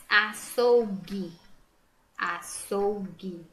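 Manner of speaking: "Açougue" slow by syllables, ending in hard gi; "Açougue" drawn out, no gw glide; careful sounding-out. Shown as a demonstration. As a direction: stretch "Açougue", U after G silent. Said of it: "Não pronuncia".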